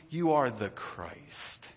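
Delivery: each word slowly, hushed; slow confession. Acted. Preacher voicing Peter, slow and soft. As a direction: draw out Peter's answer.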